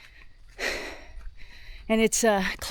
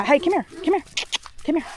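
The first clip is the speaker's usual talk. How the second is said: high, raised call to a dog; 7 semitones higher, faster than usual. Real, not acted. Calling the dog over.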